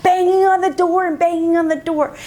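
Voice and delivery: put-on high, animated account of excited little boys; excited retelling. Excited brothers banging on door.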